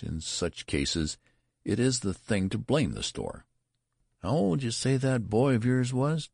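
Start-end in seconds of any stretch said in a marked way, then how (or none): 4.2-6.3 curious clerk. A narrator's reading. Clerk questioning the father about his son, curious and pushy. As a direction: quiz him like a nosy shop clerk. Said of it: "demanded the clerk curiously".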